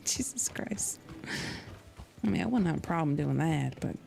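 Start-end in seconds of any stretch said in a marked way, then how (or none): 0.1-1.0 whispered aside after a laugh; an unvoiced, hushed exclamation to oneself; under the breath. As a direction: mutter it in a near whisper. Reacting to a crude joke.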